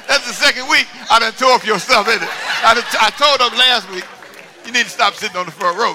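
loud words through laughter; real laughter; laughing while talking. Real, not acted.

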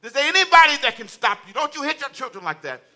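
loud, high preacher's shout; impassioned, shouted challenge to the congregation; full-force preaching. Real, not acted. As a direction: drive each question home at the top of your voice.

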